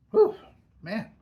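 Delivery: normal talking voice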